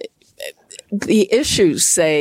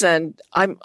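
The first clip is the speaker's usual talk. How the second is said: ordinary talk, nothing marked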